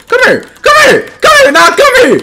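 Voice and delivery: hyped gamer yelling at the screen; rising yelled taunts. Real, not acted. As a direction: chase him down with your voice. Yelling at a fleeing opponent.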